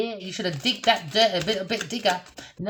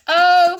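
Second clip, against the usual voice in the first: loud high cackle; laughing at her own cheek; 10 semitones higher, 11 dB louder than usual. Real, not acted. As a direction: let out a big laugh.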